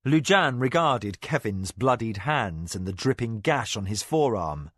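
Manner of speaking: usual speaking voice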